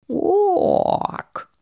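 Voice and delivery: high croaking quack; toad quack. Acted. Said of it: "sagte die Kröte".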